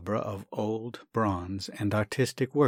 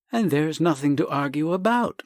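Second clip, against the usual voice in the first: relieved; 6 semitones higher, 5 dB louder than usual. Acted.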